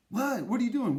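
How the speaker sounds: high startled questions; shocked, pitch jumping up; alarmed. Acted. Cave brother startled by his friend's chest, high and shocked.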